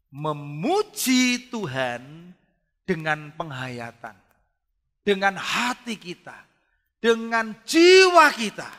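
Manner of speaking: slow, rising to loud and high; building preaching. Acted. Preacher urging heartfelt praise, slow and swelling. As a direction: build each phrase to a climax.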